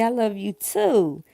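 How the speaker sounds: normal talking voice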